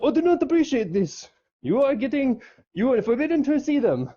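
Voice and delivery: high-pitched mock stern father; disapproving dad. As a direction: forbid it in a hammed-up high character voice. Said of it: "her dad comes over and is like".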